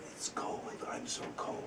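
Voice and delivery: breathy whispered ghost voice, very soft; a ghost whispering from the wall; ghostly whisper. Retold or imitated. Ghost voice in told story.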